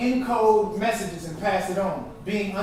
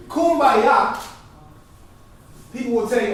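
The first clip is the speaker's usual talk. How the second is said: ordinary talk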